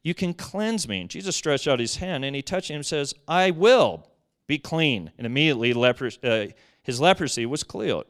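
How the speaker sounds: loud, emphatic preacher's reading of the passage; scripture reading. Acted. Preacher reading scripture loudly to the congregation. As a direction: read the gospel out with force.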